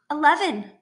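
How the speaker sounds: lightly voiced little girl. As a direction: say it like a very small child. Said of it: "She sounds like a very little girl".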